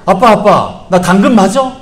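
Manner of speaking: put-on high, loud whiny kid voice; upset son pleading with dad, high and loud; upset child. Son potato asks dad.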